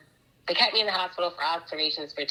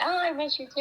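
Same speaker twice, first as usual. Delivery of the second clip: sweet reply; 11 semitones higher, faster than usual. Retold or imitated. Answering her fiance on the phone, high, lightly cooing. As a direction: coo it back sweetly.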